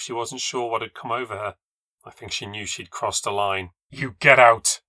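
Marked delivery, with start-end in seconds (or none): 3.9-4.8 breathed threat. Acted. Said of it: "she breathed".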